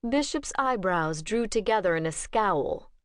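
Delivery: ordinary delivery, nothing marked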